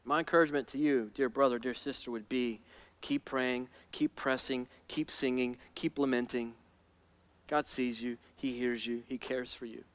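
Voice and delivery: low, soft, tender reassurance; gentle encouragement. Real, not acted. Preacher comforting a dear brother or sister, tender and hushed. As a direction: speak it very softly and low, pausing between phrases.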